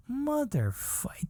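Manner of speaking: slow, high-pitched exasperated curse; exasperated disbelief. Retold or imitated. Comic reliving hearing the shocking detail, exasperated. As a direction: drag the curse out high, dumbfounded.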